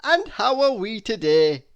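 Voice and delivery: bright booming friendly ask; jolly Mister Happy. Acted. Mister Happy cheerful greeting.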